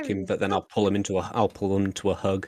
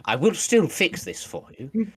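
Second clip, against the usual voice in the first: mock offence; 4 semitones higher than usual. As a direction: act scandalised. Player character offended by joke.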